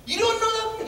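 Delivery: high retold exclamation; high-pitched retort. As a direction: pitch it way up, indignant quote. Quotes the Prophet emphatically.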